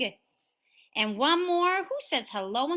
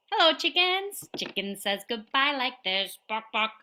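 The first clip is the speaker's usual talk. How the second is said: ordinary talk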